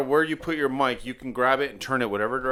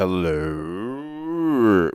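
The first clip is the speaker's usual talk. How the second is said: long drawn-out mock hello; demonstrated hello; 6 semitones lower than usual. Shown as a demonstration. Showing how you hear yourself in headphones, drawn-out hello. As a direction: stretch the word out and let it swell. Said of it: "you're like".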